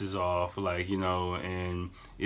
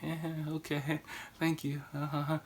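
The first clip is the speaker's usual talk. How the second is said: higher, softer polite deflection; graceful brush-off; 7 semitones higher, 6 dB quieter than usual. Shown as a demonstration. Advising how to escape drunk strangers, graceful brush-off. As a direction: demonstrate an awkwardly gracious exit. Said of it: "just kinda gracefully".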